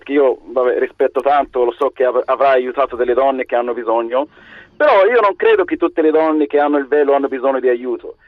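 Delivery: ordinary talk, nothing marked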